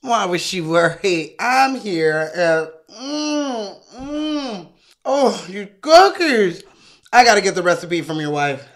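cookie-savouring, moaning mms; smug neighbour. Acted. Neighbour savouring cookies at the husband's expense, smug relish. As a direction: draw out the pleasure to taunt.

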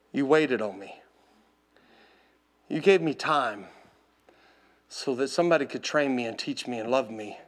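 low, hushed gratitude with long pauses; tearful prayer, retold. Owner thanking the Lord in the round pen, hushed. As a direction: softly pray it, phrase by phrase. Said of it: "I started just weeping".

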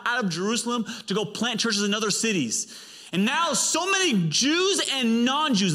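high-pitched, emphatic preacher; fervent preaching. Real, not acted. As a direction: preach it with rising fervour. Preaches with force.

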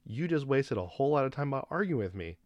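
mildly heated retort; retold indignant retort. Retold argument with rude customer.

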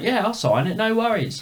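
high, hammed-up Cockney voice; chirpy mock Cockney; Cockney impression. Imitating the singer in a high Cockney voice. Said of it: "Cockney English".

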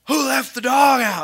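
loud, high outraged wife; angry shout. Retold or imitated. Wife finding the dog's mess, angry shout. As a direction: holler in mock outrage as the wife. Wife's angry discovery retold.

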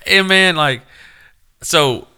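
usual speaking voice

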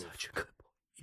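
soft breathy whisper to the dog; whispered praise. Real, not acted. Owner whispers to the new pet dog, a tender whisper. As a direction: whisper it like petting a pup.

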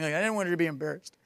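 ordinary delivery

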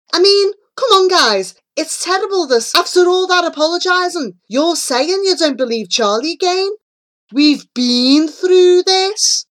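high, loud protest; indignant scolding. A narrator's reading. Wogan telling off his friends for doubting, indignant. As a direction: scold the doubters, voice pitched right up.

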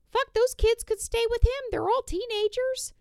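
high-pitched, loud indignation; incredulous outburst. Real, not acted. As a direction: argue it high and loud in disbelief. Incredulous rant about the kids.

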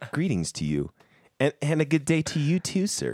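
high, prim mock-polite voice; overly formal gentleman. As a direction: lightly play a stiff polite gentleman. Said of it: "I've tried to remember that".

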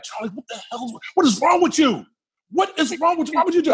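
high-pitched, alarmed mock yelling; scared onlookers. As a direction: imitate frightened friends crying out in disbelief. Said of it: "Everybody was so scared".

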